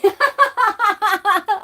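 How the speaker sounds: squealing laugh, high and loud; high burst of laughter. Real, not acted. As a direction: crack up at the joke. Laughing at the impression.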